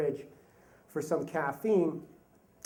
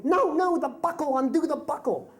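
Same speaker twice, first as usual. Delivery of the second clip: put-on a small girl insisting on the buckle; bossy little girl; 9 semitones higher than usual. Daughter scolding dad about the buckle in a high child voice. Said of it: "and she's going".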